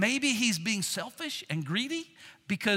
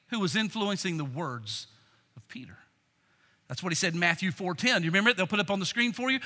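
usual speaking voice

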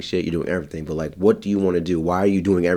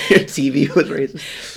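laughing; 6 semitones higher, slower than usual. Real, not acted. Cracking up at the joke.

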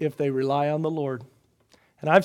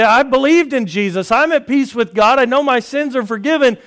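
mock lament; 7 semitones higher, 8 dB louder than usual. Voicing a struggling believer.

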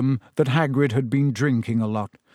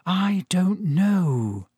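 drawn-out, doubtful, higher-pitched reply; slow, hesitant; 4 semitones higher, much slower than usual. A narrator's reading. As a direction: stretch each word out in doubt. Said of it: "Hermione said slowly".

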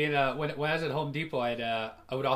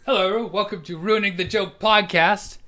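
louder, higher show-opening greeting; podcast host announcement; 5 semitones higher, 7 dB louder than usual. Pretend. Host restarts the podcast intro in a loud announcer style. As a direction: announce the show big, stretching out the title.

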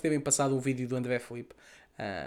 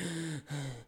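mock gasp; 10 dB quieter than usual. Imitating gasping for breath.

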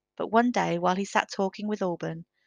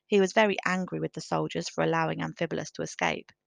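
ordinary delivery

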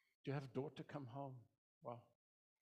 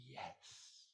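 soft, slow, hushed relief; tender father; 3 dB quieter, much slower than usual. Retold or imitated. Father in the parable seeing his son return, soft and slow. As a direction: breathe it out like a father's quiet joy.

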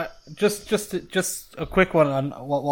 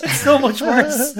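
laughing; 12 semitones higher, 7 dB louder, slower than usual. Real, not acted. Joking about being disappointed.

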